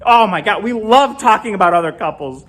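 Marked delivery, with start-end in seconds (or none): none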